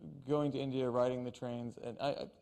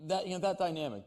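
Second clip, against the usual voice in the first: normal talking voice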